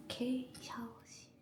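soft whisper. Real, not acted. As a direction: whisper it under your breath. Quiet tour of shower room.

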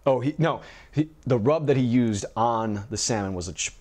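usual speaking voice

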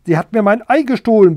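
loud, fast furious hen accusing; angry accusation. Acted. Hen Gundula accusing Bertha before the king, loud and angry. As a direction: blurt it out in a rage.

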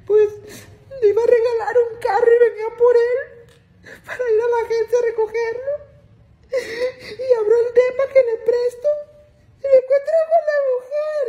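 hammed-up weepy lament; mock crying lady. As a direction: drag it out, sobbing like a jilted señora. Lady crying over her boyfriend.

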